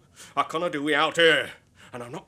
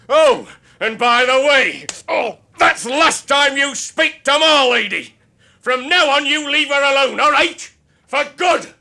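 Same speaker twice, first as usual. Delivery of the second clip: angry warning; 8 semitones higher, 3 dB louder than usual. Acted. Husband warning the man to leave his wife alone, angry shout. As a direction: lay down the law to him.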